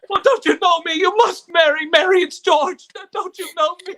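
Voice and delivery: loud, high, frantic begging; desperate pleading. Acted. Husband begging his wife who forgets him, frantic loud pleading. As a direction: plead with her to remember you.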